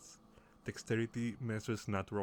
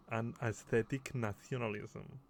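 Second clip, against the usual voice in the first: normal talking voice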